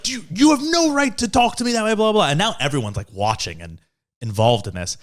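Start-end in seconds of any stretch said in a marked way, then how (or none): 0.0-2.3 mock spoiled driver demanding respect; indignant entitled girl. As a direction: mimic her outrage, pitched way up. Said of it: "she's still like".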